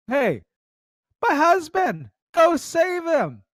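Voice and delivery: high, slow mock pleading wife; mock wife asking for help; imitating a wife. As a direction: pitch way up to plead for the husband. Voicing the quest wife.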